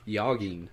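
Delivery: "Yogging" with a Y sound for the J; low, quick "Yogging", starting like yo; soft J. Shown as a demonstration. Host joking with co-host about names, soft-J mispronunciation. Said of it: "It's a soft J".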